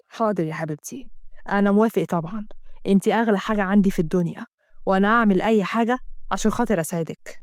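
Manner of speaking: low, placating male lead; compliant devoted lover. Acted. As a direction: give in to her tenderly.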